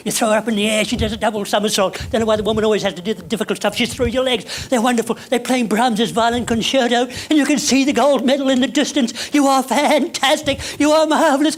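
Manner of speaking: high, loud, fast rising excitement; excited commentary. Pretend. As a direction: sweep them into the thrilling routine. Excited skating fantasy.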